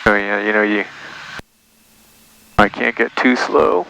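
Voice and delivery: ordinary delivery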